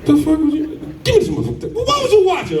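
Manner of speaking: high, mock-furious yelling; mock angry. Father catching his son with the tablet, mock angry. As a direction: catch him red-handed.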